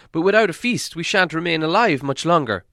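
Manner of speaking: Bombur pleading. A narrator's reading. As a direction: beg for food, voice lifted high. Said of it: "said Bombur".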